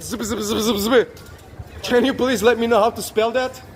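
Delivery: ordinary delivery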